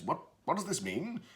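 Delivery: put-on baffled, demanding old father; curmudgeonly father, not overdone; mildly blustering father. Shown as a demonstration. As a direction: bluster a little, pitch leaping on the questions. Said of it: "not quite the blustering sort of character".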